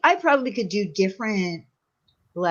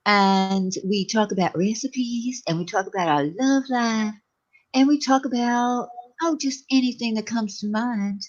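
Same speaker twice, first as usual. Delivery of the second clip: slow, drawn-out Southern drawl; put-on Southern belle drawl, unhurried; Southern accent; slower than usual. Shown as a demonstration. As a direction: stretch every phrase in a syrupy slow Southern drawl. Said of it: "I could do a Southern accent".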